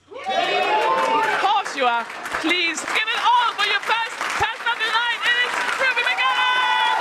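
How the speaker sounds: high, whooping stage introduction; announcer introduction. Real, not acted. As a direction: build up the act for applause. Host introduces first act to applause.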